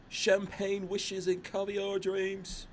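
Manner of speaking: high, drawn-out British TV host voice; slow, grand attempt at a British accent; rough British accent. Host reads out a viewer's champagne line, rough British accent. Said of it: "mine is very Cockney".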